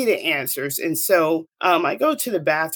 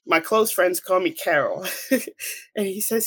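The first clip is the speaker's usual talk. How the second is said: ordinary delivery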